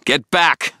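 urgent warning yell; shout. A narrator's reading. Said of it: "I shouted".

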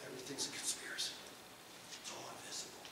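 conspiratorial whisper. Retold or imitated. Mocking conspiracy talk.

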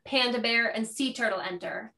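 ordinary talk, nothing marked

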